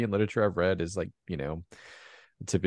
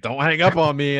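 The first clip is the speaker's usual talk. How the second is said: high, loud mock telling-off; hammed-up angry phone plea; mock demand; 8 semitones higher, 7 dB louder than usual. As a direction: play the guy snapping down the phone. Retold phone fight.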